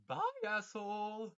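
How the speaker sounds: loud, high, slow drawn-out jeer; mocking shout. Real, not acted. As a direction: fling the insult out slowly. Mocking sign-off.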